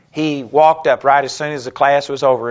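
normal talking voice

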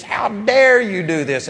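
a touch of an angry mentor; mean scolding. Retold or imitated. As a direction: retell the youth director's angry rebuke. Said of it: "he was mean to me".

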